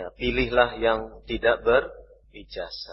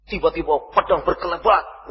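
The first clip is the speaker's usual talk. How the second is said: raised storytelling intonation; 4 semitones higher than usual. Shown as a demonstration. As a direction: lift the voice for the battle moment. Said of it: "intonasinya di sedikit naikkan". (intonasi bercerita yang dinaikkan)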